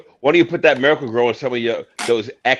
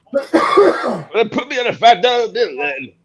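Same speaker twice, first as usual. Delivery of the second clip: Abraham Lincoln impression; 10 semitones higher, 3 dB louder, slower than usual. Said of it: "Abraham Lincoln redo".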